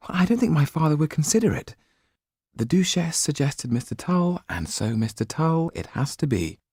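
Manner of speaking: put-on a young noblewoman explaining, a little anxious; young woman. A narrator's reading. Sido's voice held by narrator.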